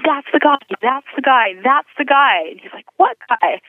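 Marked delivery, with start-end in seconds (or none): 0.1-2.5 high, insistent, repeated exclamation; excited recognition. Retold or imitated. Woman elbowing her boyfriend about the pastor, excited. 3.0-3.6 high, quick retold query; puzzled question. Boyfriend answering his elbowing girlfriend, puzzled.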